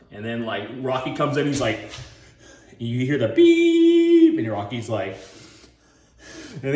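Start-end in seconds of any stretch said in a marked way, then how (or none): 2.8-4.4 high, loud, slow flat beep; monitor beep. Retold or imitated. Flatline heart monitor, imitated beep. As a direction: drone like a flatline.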